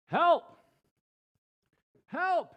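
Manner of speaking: high, loud desperate shout; panicked cry for help hanging off a cliff; cries for help. A narrator's reading. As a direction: yell for help from far below. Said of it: "he cried".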